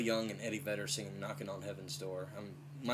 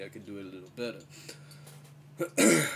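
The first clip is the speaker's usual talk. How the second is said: ordinary talk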